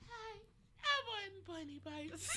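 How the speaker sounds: put-on high, soft, slow baby voice; tiny breathy baby voice; cat and mouse voice. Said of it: "that little cat and mouse voice".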